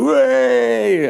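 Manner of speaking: high, drawn-out cheer; long high hooray; mock crowd cheer. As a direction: imitate a crowd of listeners cheering. Listeners cheer in the story.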